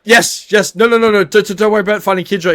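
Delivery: calm them down in a rush; flustered Bong. Acted. Bong hurriedly telling the party not to worry, flustered.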